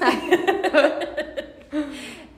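loud, high burst of laughter; real sustained high cackle; big laugh. Real, not acted. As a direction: laugh hard at yourself. Laughs about reposting TikToks.

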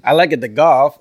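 put-on loud, off-target Scottish accent; bad Scottish accent. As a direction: imitate a Scottish accent that sounds like Borat. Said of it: "You sound like Borat".

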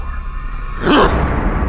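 sharp high loud cry of pain; a shocked pained gasp; agonized gasp. Acted. Van Helsing struck by necrotic energy, loud pained gasp.

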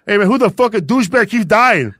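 angry gamer yell. Retold or imitated. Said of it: "these fools will be like".

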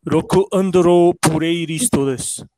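slow Japanese-accented English. Shown as a demonstration. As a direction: say it like a Japanese speaker, slowly. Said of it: "em sotaque japonês".

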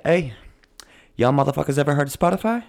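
quick, pushy salesman pitch; lightly voiced hustler pitching a deal; a hint of a fast-talking salesman; voicing Anchor. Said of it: "Anchor's like".

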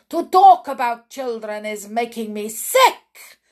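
loud witch cry of disgust; witch scream. Acted. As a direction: scream it sickened. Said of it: "screamed the Grand High Witch".

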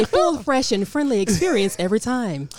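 corporate voice. Pretend. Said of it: "she went straight corporate".

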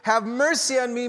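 high, loud, slowed plea on "Lord"; desperate mother crying out to Jesus for her daughter, pleading loudly; retold cry for mercy. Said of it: "She just cries out".

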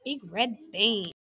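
ordinary delivery

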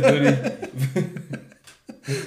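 langsam, durch Lachen gesprochen; lachend. Real, not acted. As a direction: vor Lachen kaum rausbringen. Lacht beim Überlegen. (lachend gesprochen)

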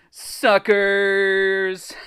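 loud, high, long-held word; gloating punchline. Acted. Reader lands the story's final joke, gloating punchline. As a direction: belt it out and stretch it.

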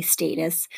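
"status" with a long a, as in "state"; long-a pronunciation. Shown as a demonstration. Teacher citing the pronunciation guide, long-a "status". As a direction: say "status" as STAY-tus. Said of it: "this pronunciation guide does say status".

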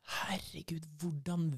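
hushed amazed whisper, slowing; awed whisper. Retold or imitated. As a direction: gasp it in a stunned whisper. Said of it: "Og så sier hun".

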